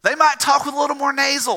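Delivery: Southerner's rough stab at Northern nasal speech; attempted Northern accent. Shown as a demonstration. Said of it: "I can't really do a Northern accent".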